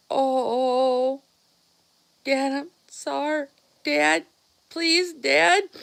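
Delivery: high, pleading whimper; a scared boy begging for mercy; terrified begging. Retold or imitated. Boy backs away from his furious dad, pleading high. Brother begs angry dad.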